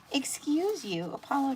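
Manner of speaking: high, mock-offended "Excuse you"; mock scolding the dog. Real, not acted. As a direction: tease the burping dog, pitch jumping up. Apollo just burped on me.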